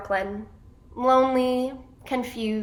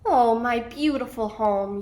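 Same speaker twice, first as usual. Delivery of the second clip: slow, drawn-out wistful cry easing off; storyteller's longing reminiscence; reminiscing; 6 dB louder, slower than usual. A narrator's reading. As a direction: sigh over a remembered home, stretching the opening. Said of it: "Yetta reminisces".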